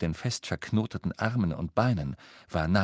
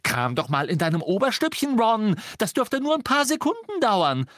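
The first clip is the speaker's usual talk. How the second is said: climbing to a high screech; scathing, furious mockery; biting sarcasm; 13 semitones higher, 4 dB louder than usual. A narrator's reading. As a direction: jeer at him, rising to the end. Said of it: "mit beißendem Sarkasmus".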